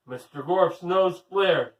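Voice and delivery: ordinary delivery, nothing marked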